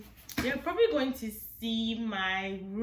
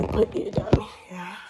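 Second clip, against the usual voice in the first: whispering; 3 dB louder than usual. Real, not acted. Whispering while others may sleep.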